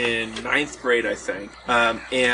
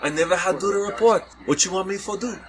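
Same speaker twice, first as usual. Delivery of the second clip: lightly voiced local kid in Hawaiian pidgin, a little higher; 7 semitones higher than usual. Local kids asking the teacher, in light Hawaiian pidgin. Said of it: "Hawaiian pidgin".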